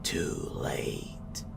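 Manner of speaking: soft, airy ghost whisper; ghostly whisper. A narrator's reading. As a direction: breathe it like a ghost on the wind. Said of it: "I heard a whisper on the breeze".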